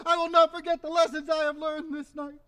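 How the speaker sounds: usual speaking voice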